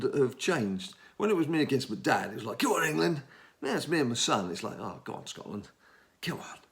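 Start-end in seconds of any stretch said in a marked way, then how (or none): 2.6-3.2 higher, spirited football cheer; re-enacted eager supporter's call; keen cheering. Keen cheer against his dad. 4.8-6.6 soft, low, grudging encouragement; resigned supporter giving in; half-hearted cheering. Retold or imitated. Reluctant cheer for son's team.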